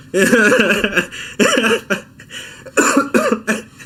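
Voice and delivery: long high burst of laughter; laughing hard. Real, not acted. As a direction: crack up at your own joke. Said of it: "cracked myself up".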